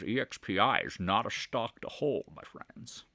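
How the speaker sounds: hammed-up celebrity impression with a Scottish lilt; Sean Connery. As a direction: do a slurred Scottish accent, measured pace. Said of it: "Sean Connery says".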